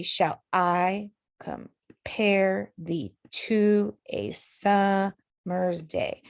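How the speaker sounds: very slow, weight on "I", "to" and the start of "summer's"; marking stressed syllables. Shown as a demonstration. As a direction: spell out the beats of "Shall I compare", syllable by syllable. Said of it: "the emphasized ones would be".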